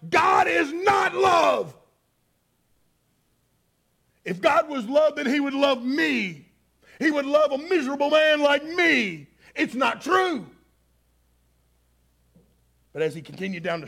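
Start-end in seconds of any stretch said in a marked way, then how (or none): 0.1-1.8 bitter shout, slow and high; not a mutter but a loud bitter cry; angry outburst. Retold or imitated. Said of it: "he muttered to himself". 4.2-10.5 bitter rant. Retold or imitated. Drunk man's angry rant.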